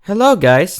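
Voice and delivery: ordinary delivery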